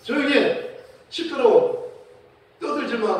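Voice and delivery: loud, high scolding shout; imitated irritated telling-off; acted angry hushing. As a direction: shout down a noisy beggar, sharply. Disciples scolding the shouting beggar.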